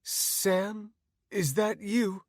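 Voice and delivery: high, weak, dazed woman's voice; groggy, high-pitched plea. Acted. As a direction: call out as if barely awake. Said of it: "she said groggily".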